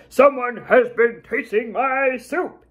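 big gruff bear roar, hammed up for a story; roaring papa bear. A narrator's reading. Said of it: "he roared".

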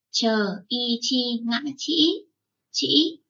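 slow spelling of chỉ with the ngã tone. Shown as a demonstration. Teacher spelling the ngã tone word for children. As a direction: spell it slowly and clearly. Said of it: "các con nhé".

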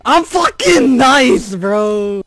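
high, slow, loud crowing; drawn-out victory boast; boastful shout. Real, not acted. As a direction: yell your brag in triumph. Boasting after a play.

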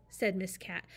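usual speaking voice

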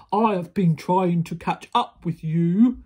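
big, loud giant voice; booming giant. A narrator's reading. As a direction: boom it out like a huge giant. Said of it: "boomed the big voice of the giant".

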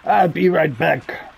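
slow mock copy of the cop's line; a light parody of the policeman, drawn out; imitating the cop. Player mimicking the game cop's line, slow mock voice. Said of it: "he says".

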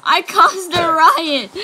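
slow, loud, high proclamation; loud drawn-out cry of delight; excited shout. Real, not acted. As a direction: announce the chaos with glee. Llama fight breaks out.

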